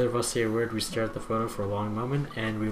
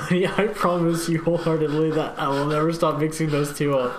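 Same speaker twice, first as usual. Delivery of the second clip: mock solemn promise; 6 semitones higher, 4 dB louder than usual. Dad teasing promise, hammed.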